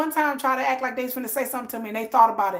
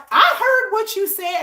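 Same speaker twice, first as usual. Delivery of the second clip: retold angry shout; 8 semitones higher, 5 dB louder than usual. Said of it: "he was like".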